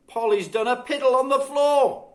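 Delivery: high, loud telling tales; a child telling on her sister; tattling girl. A narrator's reading.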